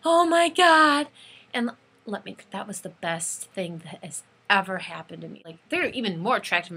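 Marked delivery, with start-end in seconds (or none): 0.0-1.1 high, loud, drawn-out squeal; slow mock scream of joy; imitated fan scream. Fan reliving her exit from the tent, mock screaming. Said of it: "I, like, screamed".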